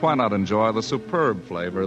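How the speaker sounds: ordinary delivery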